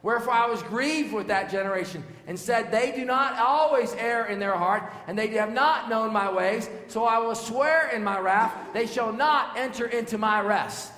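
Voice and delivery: loud, high, fiery scripture reading; preaching at full force. Acted. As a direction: thunder the verse out.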